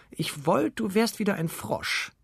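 high-pitched, horrified princess; startled cry. A narrator's reading. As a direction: cry it out high in disgusted fright. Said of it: "erschreckte sich die Prinzessin und rief". (hoher, erschrockener Ausruf)

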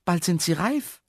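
high, hopeful character voice; pleading Herr Jaromir. A narrator's reading. Man begs caretaker to spare plants, high plea.

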